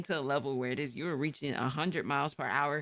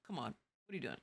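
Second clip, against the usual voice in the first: much softer, lower grumble at a bad driver; hushed weary disbelief; very soft exasperated mutter; 5 semitones lower, 11 dB quieter, much faster than usual. Shown as a demonstration. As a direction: barely voice the complaint. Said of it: "I say it just like that".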